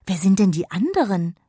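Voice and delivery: bursting with excitement. Acted. Jonna asks the fairy eagerly, excited and loud. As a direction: ask it nearly bursting with curiosity. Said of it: "vor Aufregung fast platzte".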